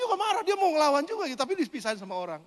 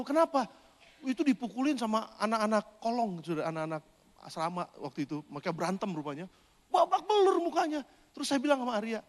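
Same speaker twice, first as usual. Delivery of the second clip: usual speaking voice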